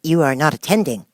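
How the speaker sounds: stern scolding mouse; severely. Acted. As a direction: reprove her sharply. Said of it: "said the Mouse to Alice severely".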